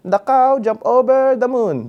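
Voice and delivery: put-on high, loud childlike recital; kid reciting a poem. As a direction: recite proudly like a kindergartner in front of adults. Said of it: "And I was like".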